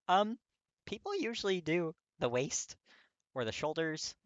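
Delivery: put-on a flustered young guy stumbling over an awkward answer. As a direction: squeak it out, embarrassed. Kirishima flustered by the question.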